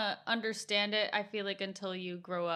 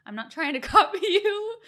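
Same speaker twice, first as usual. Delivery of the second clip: higher, louder, laughing through the quote; laughing self-quote; 9 semitones higher, 10 dB louder than usual. Retold or imitated. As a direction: laugh it off, embarrassed. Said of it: "because I'm like".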